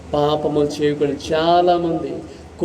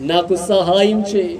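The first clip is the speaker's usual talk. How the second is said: usual speaking voice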